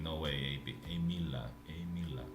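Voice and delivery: ordinary delivery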